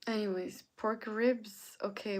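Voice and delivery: normal talking voice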